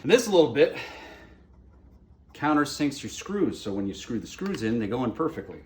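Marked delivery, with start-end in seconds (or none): none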